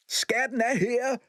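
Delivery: high, slow triumphant call. A narrator's reading. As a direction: announce the find. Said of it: "råbte Vitello".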